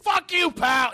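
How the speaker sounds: high-pitched irate outburst; yelling customer. Acted. Yelling customer telling off the store manager, high and angry. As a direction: throw it out high, mock-furious. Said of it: "He's like".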